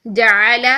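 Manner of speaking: جعل read with the final لام closed, no vowel after it; stopped pronunciation. Shown as a demonstration. Teacher showing students a stop on جعل, clipped ending. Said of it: "stop کرتے ہوئے جعل".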